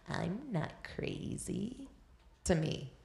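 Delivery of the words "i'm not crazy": hushed, drawn-out imitation of an eerie voice; weird possessed voice. Foster boy with a demonic spirit talking to his foster mother, imitated weird voice. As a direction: imitate a possessed child, slow and much softer. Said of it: "he said in a weird voice".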